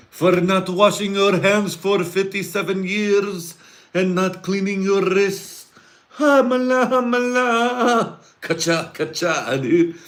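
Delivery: hammed-up high shrill-free mock chant of punishment; mock executioner. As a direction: ham up a zealot executioner. Mock executioner punishing hand-washers.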